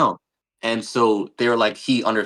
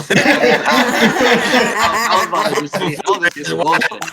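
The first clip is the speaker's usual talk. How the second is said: high, louder, laughing through the words; 10 semitones higher, 6 dB louder than usual. Real, not acted. Laughing at his own near-blunder in the story. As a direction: crack up while telling it.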